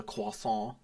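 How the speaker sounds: croissant with a French accent. Shown as a demonstration. Showing off the one word they can manage, French accent. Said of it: "I can just do the".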